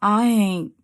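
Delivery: deliberate, punchy poetry opening; spoken word poem. Acted. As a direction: set up the line with weight.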